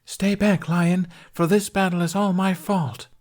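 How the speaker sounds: high, loud heroic order; stage knight. A narrator's reading. Yvain orders the lion back, high and heroic. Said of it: "Tooth, as Yvain".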